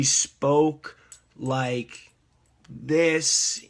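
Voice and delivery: slow, feeble imitation of a sick old lady. Imitating a sick woman in a wheelchair, slow and weak. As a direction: drag each word out softly, as if frail. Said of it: "very sick old lady".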